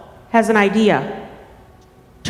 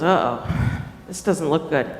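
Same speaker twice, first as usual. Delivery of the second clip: low, softer uneasy aside; worried turtle; 9 semitones lower, 6 dB quieter than usual. Acted. Storyteller voices Turtle facing big teeth, mildly worried. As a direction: drop the voice, lightly worried. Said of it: "And Turtle goes".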